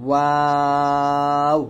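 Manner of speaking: ওয়াও held long with rounded lips; drawn-out waw. Shown as a demonstration. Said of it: "ওয়াও দুই ঠোঁট গোল করিয়া".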